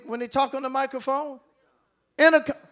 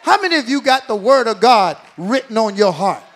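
ordinary talk

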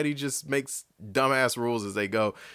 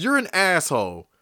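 normal talking voice

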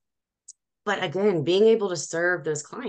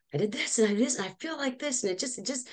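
higher-pitched, soft excited gush; 7 semitones higher, 4 dB quieter, faster than usual. Retold or imitated. Telling a best friend about organizing her house, excited gushing.

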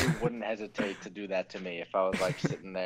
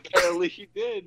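laughing; 5 dB louder than usual. Real, not acted. Cracking up while answering.

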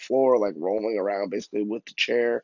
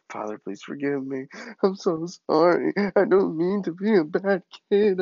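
imitated tearful prayer rising high and loud; full cry; 6 semitones higher, 3 dB louder than usual. Boy praying on hands and knees, mock crying plea. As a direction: build from plea to mock bawling. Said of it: "I'm in full cry at this point".

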